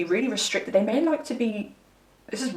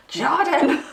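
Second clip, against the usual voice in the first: louder, higher Jarden with a broad Leeds ar, laughing through it; Leeds accent; 7 semitones higher, 6 dB louder than usual. Retold or imitated. Jordan from Leeds correcting her on his name, in a broad Leeds accent. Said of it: "that's a Leeds accent for you".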